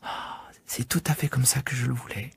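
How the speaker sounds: soft, breathless, lit-up whisper; child marvelling softly; delighted whisper. Acted. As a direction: hush it out in wonder. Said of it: "s'illuminer le visage".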